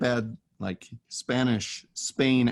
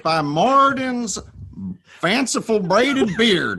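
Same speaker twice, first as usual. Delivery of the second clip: high, loud mock Southern dwarf; Southern dwarf oath; 10 semitones higher, 4 dB louder than usual. Dwarf swearing by Moradin in a high, loud Southern accent.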